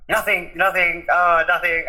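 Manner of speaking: flustered denial. Retold or imitated. Son backtracking to mum after blurting it out, flustered.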